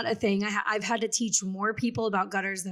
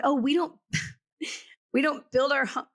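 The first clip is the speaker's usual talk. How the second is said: put-on terrible Southern accent; 5 semitones higher than usual. Aunt explaining Florida homebuilding, exaggerated Southern accent. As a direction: do a bad Southern accent, pitched up. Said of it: "that was a terrible Southern accent".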